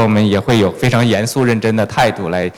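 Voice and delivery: normal talking voice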